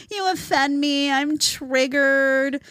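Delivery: slow mock offended whine; mocking whiny snowflake. Host mimicking offended young people, slow and whiny. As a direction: drag out the mock complaint. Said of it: "nauseating prima donna babies".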